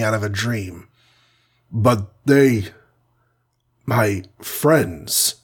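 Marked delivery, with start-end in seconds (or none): 1.7-5.4 falter, with long gaps between phrases; dazed man struggling to get words out; broken, halting speech. A narrator's reading. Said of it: "he said brokenly".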